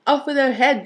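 a mild roar, pitch lifted; mock roar. A narrator's reading. Prince ordering the sister's execution, mock roar. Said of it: "prince roared back".

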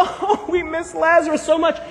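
imitating a mourner's lament; hammed-up grieving; mock wailing. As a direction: put on a plaintive wail. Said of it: "Everyone's wailing".

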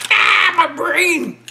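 high, slow, loud squeaky cry of pain; brain freeze. Acted.

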